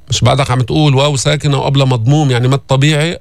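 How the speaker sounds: ordinary delivery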